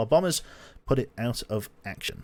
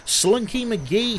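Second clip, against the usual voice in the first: announcer shout-out; 12 semitones higher, 4 dB louder, much slower than usual. Acted. Thanking a subscriber on stream.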